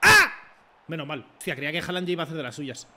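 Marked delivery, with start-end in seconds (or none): 0.0-0.3 sudden high yelp of fright; a sharp startled cry as the rival nearly scores; startled yell. Real, not acted. Streamer yelps in fright at a near goal.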